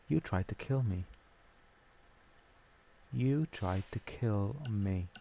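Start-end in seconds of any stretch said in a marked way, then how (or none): 3.1-5.1 drawn out, word by word; half-speed, deliberate mock accusation; slow retold accusation. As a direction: spell out the accusation very slowly. Retold accusation repeated slowly for emphasis.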